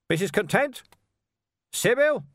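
high call through the house; yelling. A narrator's reading. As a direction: call out for someone, pitch climbing sharply. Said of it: "he yelled".

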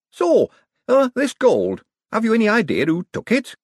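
loud high-pitched anxious question; nervous high voice. Acted. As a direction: blurt it out nervously.

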